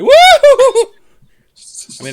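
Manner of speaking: high, loud whooping shout; goofy yell. Shown as a demonstration. Friend imitating Jim Carrey's yell, loud woo. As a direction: let out a big silly woo. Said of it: "He can really yell".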